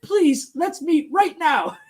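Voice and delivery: mock plea, high and slow; pleading Europeans. Host quotes Europeans calling up for a meeting, mock pleading. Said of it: "they called up and they said".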